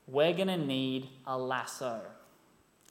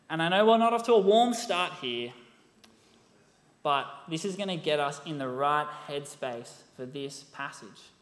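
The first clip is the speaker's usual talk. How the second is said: usual speaking voice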